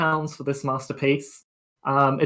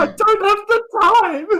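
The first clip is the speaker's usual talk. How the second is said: much higher, slow, hammed-up crying delivery; melodramatic wail; 5 dB louder than usual. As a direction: wail it like a soap opera tragedy. Melodramatic repeat of own line.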